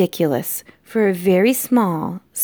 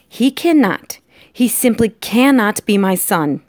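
higher, emphatic protest; despairing father; 4 semitones higher, 4 dB louder than usual. Acted. Lester disowns his mouse son, in despairing protest. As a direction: protest in raised disbelief.

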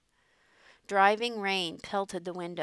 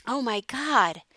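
shocked cry; 4 semitones higher, 7 dB louder, slower than usual. A narrator's reading. Father sees giant wave.

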